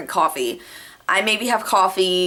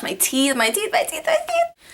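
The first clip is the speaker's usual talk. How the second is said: climbing higher with each my teeth; rising ecstatic squeal; 13 semitones higher than usual. Retold or imitated. Girl free of braces squealing over her new teeth, building from excited to shrieking.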